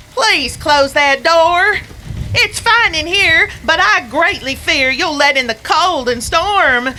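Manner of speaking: a dead man cosy in the fire, pleased; cheerful Sam McGee. Acted. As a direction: Sam calling out happily from the furnace. Sam speaks from the furnace.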